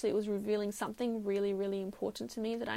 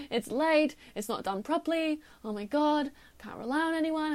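higher, loud, fed-up complaining; irritated rant; 5 semitones higher, 4 dB louder than usual. Retold or imitated. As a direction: rant that nobody can be relied on. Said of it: "He was so irritated".

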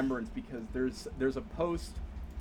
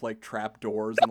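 ordinary delivery

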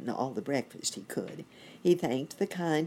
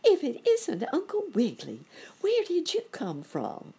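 high, louder tiny ant voice; exclaiming ant; 12 semitones higher, 4 dB louder than usual. A narrator's reading. Ant greeting Uncle Wiggily, high excited voice. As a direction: squeak it up high like a small ant. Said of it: "exclaimed a voice all at once".

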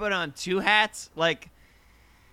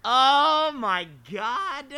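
drawn-out shock; 8 semitones higher, 4 dB louder, much slower than usual. Real, not acted. Shocked at kinky reveal.

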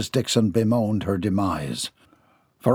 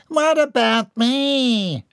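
high, slow whining; whiny protest; 12 semitones higher, much slower than usual. Acted. John whines about being ignored. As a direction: protest pitifully. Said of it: "John protested".

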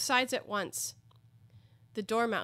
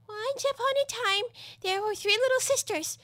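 hurried; 5 dB louder than usual. A narrator's reading. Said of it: "began in a great hurry".

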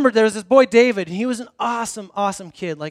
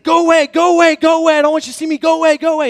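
high, loud, frantic pleading to go away; panicked; 8 semitones higher, 9 dB louder than usual. Retold or imitated. The boy in the bathroom begs his friend to leave, panicked. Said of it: "And just starts panicking".

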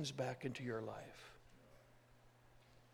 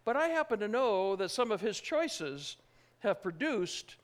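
ordinary delivery

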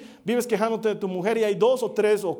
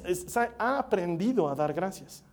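ordinary talk, nothing marked

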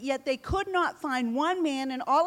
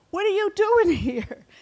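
high, loud scoffing with a laugh; mocking big brother; 7 semitones higher, 6 dB louder than usual. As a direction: laugh him off as a nuisance. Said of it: "his brother was so upset".

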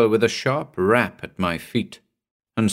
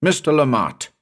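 higher-pitched, moderately raised calling-out; shout; 6 semitones higher than usual. A narrator's reading. Narrator calling the mate into darkness, contained shout. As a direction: call urgently for the missing mate. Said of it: "I shouted".

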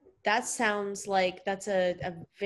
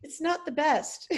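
laughing; 8 semitones higher, 3 dB louder than usual. Real, not acted. Laughs at bad accent.

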